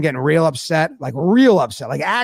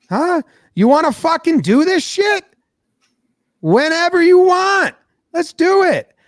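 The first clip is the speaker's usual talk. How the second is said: high-pitched acted-out dare; upset; 10 semitones higher, 3 dB louder than usual. Pitcher daring rival to fight, high heated challenge. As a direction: goad him into a fight, voice pitched way up. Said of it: "I'm upset about this".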